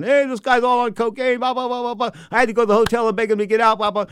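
high, loud announcer parody; mock TV announcement. Host imagining telling TV crowd the truth, mock announcing. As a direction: pretend to blurt the scandal to a crowd. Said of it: "not gonna tell the T V crowd".

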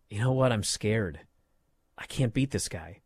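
imitating a wrestler admitting he's scared; mock scared admission. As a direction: let it sink into a small, beaten voice. Voicing Tony admitting fear.

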